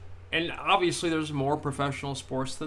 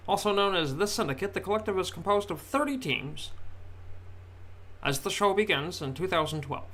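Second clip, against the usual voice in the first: transatlantic radio announcer; 4 semitones higher than usual. Acted. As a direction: announce it like an old-time newsreel. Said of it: "I gotta do the whole nineteen thirties, uh, transatlantic radio announcer voice".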